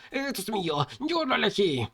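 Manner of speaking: high whimpering protest; put-on tearful coward voice; almost crying. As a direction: whine it as if close to tears. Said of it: "llorando casi".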